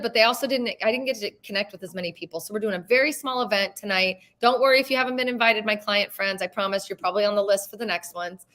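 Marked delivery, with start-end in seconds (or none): none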